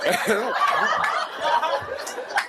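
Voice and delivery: a rising, airy giggle; real breathy laughter; high breathy giggle. Real, not acted. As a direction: giggle helplessly. Laughing at the story.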